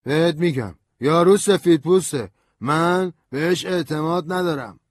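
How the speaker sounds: put-on high drunken voice insisting; stubborn drunk. A narrator's reading. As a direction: refuse flatly to trust him. Drunk lawyer refusing.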